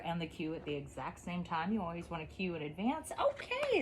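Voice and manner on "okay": bright high release cue. Real, not acted. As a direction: sing out the release word high. Release word for puppy.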